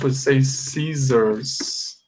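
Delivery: scissors said slowly, both double S as Z; slow model word. Shown as a demonstration. Teacher showing students how scissors sounds, slowly. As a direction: stretch scissors out for learners. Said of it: "In English".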